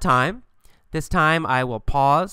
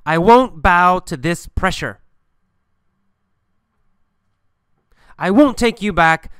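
firm, louder refusal; mild acted yell; 3 semitones higher, 5 dB louder than usual. Man refuses his ex who pressures him, mild acted yell. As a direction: raise the voice and stand firm, without really shouting. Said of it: "He yells at Julia and says".

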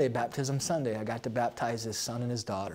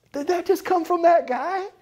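astonished disbelief; 7 dB louder than usual. Retold or imitated. Pastor's astonished reaction retold.

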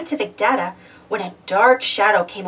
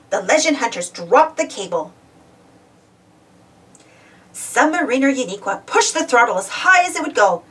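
usual speaking voice

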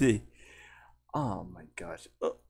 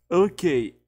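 higher, louder character "okay"; Levi; 6 semitones higher, 6 dB louder than usual. Shown as a demonstration. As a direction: pitch it up, punchy and short. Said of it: "Levi sound like more this".

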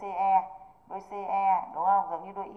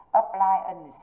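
"Appliance" with the second syllable punched; stress on second syllable; 9 dB louder than usual. Shown as a demonstration. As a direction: stress the PLI in "Appliance", then let it fall. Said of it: "trọng tâm rơi vào âm tiết P".

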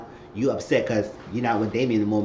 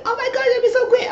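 very high, louder squeal with a laugh; overjoyed squeal; 6 dB louder than usual. Retold or imitated. Mother celebrating son's callback, high delighted shriek. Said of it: "He said".

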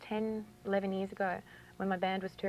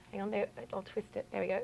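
ordinary delivery

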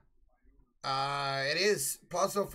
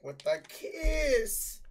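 imitating voice crack; 7 semitones higher, much slower than usual. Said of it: "I heard her voice crack".

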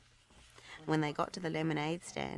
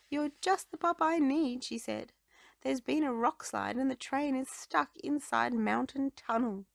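worried mayor; 9 semitones higher, 5 dB louder than usual. A narrator's reading.

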